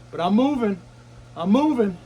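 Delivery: strained, forcing the words out; pushing through the cold; in ice cold water. Real, not acted.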